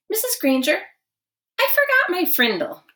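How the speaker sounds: high, bright innocent schoolboy; Nick's innocent voice. Acted. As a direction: play it innocent, pitched well up. Nick speaks to teacher.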